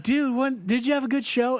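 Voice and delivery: high, bouncy mock-happy question; cheerfully oblivious; lightly voiced happy Andy; cheerful bandmate. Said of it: "He's all happy".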